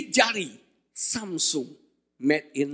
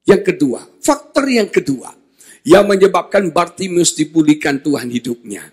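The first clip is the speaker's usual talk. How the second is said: ordinary talk, nothing marked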